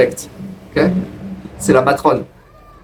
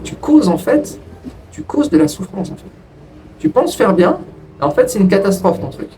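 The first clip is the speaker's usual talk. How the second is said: self-reproach; 5 semitones higher than usual. Retold or imitated. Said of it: "j'étais en train de me dire".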